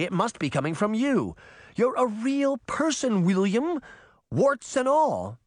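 very high, insistent lecture; exasperated, high-pitched pep talk; impassioned Sabrina. A narrator's reading. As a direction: drive it home in a high, urgent voice. Sabrina berates Charming in story.

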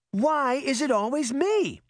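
high-pitched indignant complaint; shocked protest. A narrator's reading. Girl blamed by her granny, shocked and protesting. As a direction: protest in a high, put-upon voice. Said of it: "Sabrina was shocked".

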